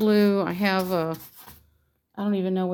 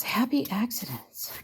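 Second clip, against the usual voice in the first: soft whispered Bob Ross; breathy gentle painter whisper; 7 dB quieter than usual. Retold or imitated. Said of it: "as Bob says".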